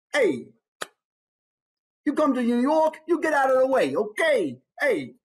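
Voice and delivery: high, pushy New York tough guy; in-your-face New Yorker. Acted. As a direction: get in their face, New York style. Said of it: "He's right there in your face".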